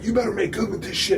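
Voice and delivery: high-pitched imitated teammate warning; urgent scolding. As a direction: scold him in a high, put-on voice.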